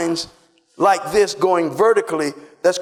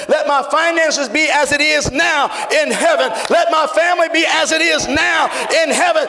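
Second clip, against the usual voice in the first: preaching, building to a roar; 6 semitones higher than usual. Acted. Pastor leads the church in declarations, building to a roar. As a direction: climb step by step to the peak.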